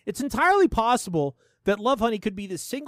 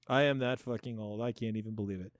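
low, much softer repeat; resigned disbelief; 10 semitones lower, 9 dB quieter than usual. Real, not acted. Host admitting being old, in resigned disbelief. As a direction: drop it to a low grumble to oneself. Dejected repeat about own age.

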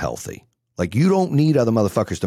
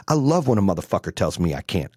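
ordinary delivery

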